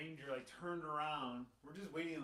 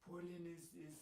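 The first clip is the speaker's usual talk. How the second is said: a put-on French accent, mimicking a stranger; a light French accent on a stranger's words; 2 semitones higher, 5 dB quieter than usual. A stranger in the coffee line speaking, in a French accent. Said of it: "my French accent's gonna suck".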